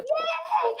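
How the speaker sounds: very high, small-volume yay; excited cheer. Real, not acted. Trainer celebrating that finishers get the prize, excited cheer. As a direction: let out a high, soft squeal of a cheer.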